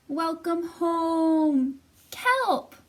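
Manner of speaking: high, loud, stretched-out greeting; joyful welcome. Acted. Narwhal friends welcoming Kelp home, joyful and loud. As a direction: call it out like a happy crowd.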